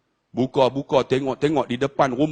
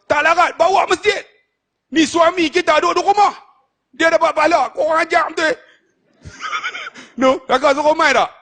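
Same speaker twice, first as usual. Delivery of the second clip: much higher and louder, rousing; fiery preaching; 12 semitones higher, 4 dB louder than usual. Acted. Preacher urging wives in the crowd, rousing and loud. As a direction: fire up the congregation.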